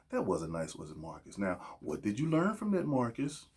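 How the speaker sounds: soft, coddling parental reproach; hushed tut-tutting, lifting into a coaxing question; mock gentle parent. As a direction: gently quiz a child, mockingly. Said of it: "I'm his dad or his older uncle".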